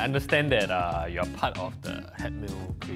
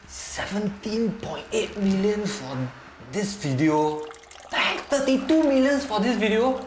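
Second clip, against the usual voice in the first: shocked; 7 semitones higher than usual. Real, not acted. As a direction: gasp at the numbers. Shocked at view counts.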